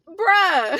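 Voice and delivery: high, loud disbelieving outburst; incredulous outrage. Retold or imitated. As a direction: call out a friend in mock outrage. Retelling outrage at overheard laughing.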